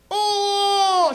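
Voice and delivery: loud, high sudden wail; imitated baby cry. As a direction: burst out wailing like a baby. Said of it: "I can cry like a baby".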